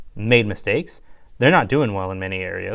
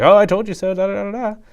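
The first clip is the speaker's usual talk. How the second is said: higher, louder teasing I-told-you-so, trailing into sing-song da da; mock told-you-so; 9 semitones higher, 5 dB louder than usual. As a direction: gloat it like a smug teammate. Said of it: "somebody was just like".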